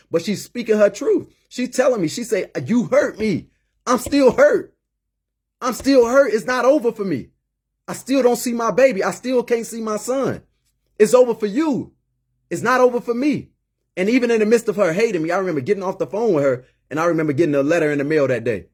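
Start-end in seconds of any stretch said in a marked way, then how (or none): 2.7-13.5 imitating a hurt mother, pitched much higher; high, emphatic plea, acting out her pain; retold bawling. Mother on the phone telling son it's not over for her, retold bawling. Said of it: "and she's, and she's bawling".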